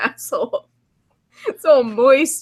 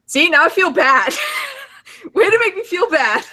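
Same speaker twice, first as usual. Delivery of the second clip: laughing; 8 semitones higher than usual. Real, not acted. Laughing at being made sad.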